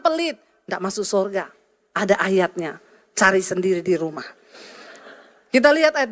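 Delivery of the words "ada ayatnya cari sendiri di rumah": ordinary delivery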